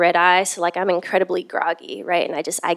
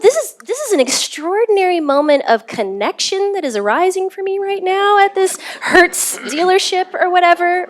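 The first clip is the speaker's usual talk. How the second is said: high, loud, marveling rush of wonder; amazed inner thought; 11 semitones higher, 4 dB louder than usual. Retold or imitated. Traveler marveling to herself at the rental dealership, high excited wonder. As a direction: marvel at it in a raised, animated voice.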